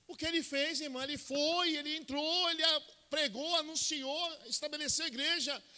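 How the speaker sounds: high, loud fiery preaching at full pitch; full-force preaching. Acted. Preacher rallying the church, loud and fiery. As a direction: drive it home to the congregation.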